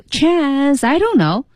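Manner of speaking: chance 和 I don't know 连在一块儿说; linked phrasing. Shown as a demonstration. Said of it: "是连在一块儿说的". (连读不停顿)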